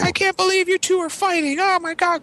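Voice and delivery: very high, shrieky mock mother; appalled mom.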